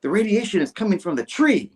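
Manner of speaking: high, dramatic declaration; hammed-up cameraman. Cameraman announcing where radiation comes from, theatrical and high. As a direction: proclaim it as a grand revelation.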